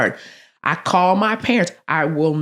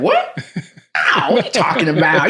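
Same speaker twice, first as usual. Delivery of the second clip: shocked disbelief; 3 dB louder than usual. Retold or imitated. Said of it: "I'm getting upset".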